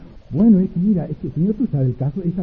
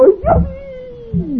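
much higher, slow, stretched "¡Ay, Dios mío!"; overjoyed cry; 5 dB louder, much slower than usual. Acted. Pastor hearing his debts are paid, crying out with joy, much higher and drawn out. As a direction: let it burst out in slow delight. Hears the exact sum, jumps.

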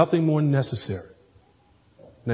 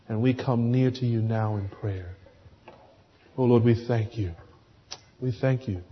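closing prayer; faster than usual. Acted.

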